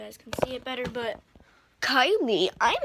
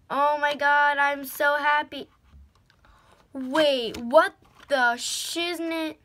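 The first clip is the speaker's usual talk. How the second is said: excited; 3 semitones higher, 7 dB louder than usual. Real, not acted. Discovering extra lipsticks.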